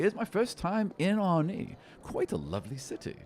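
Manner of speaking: a sly little seeker teasing his find; halfling seeker. Acted. Halfling chatting on the walk.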